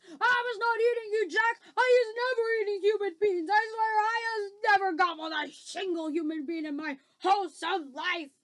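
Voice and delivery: loud, high, frantic protest; screeched denial. Acted. Giant swears to Jack he ate nobody, screeching. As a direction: wail your innocence in terror. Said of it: "he screeched".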